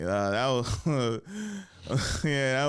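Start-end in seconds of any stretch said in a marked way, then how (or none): none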